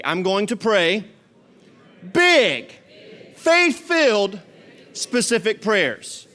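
"big" and "faith-filled" shouted high, slow; call and response. Shown as a demonstration. Pastor leads his church in the phrase, loud and slow. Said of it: "Everybody say that with me".

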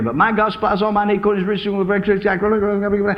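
rattled off over and over; quick murmured recitation; rapid repeating. Retold or imitated. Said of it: "just motor mouthing".

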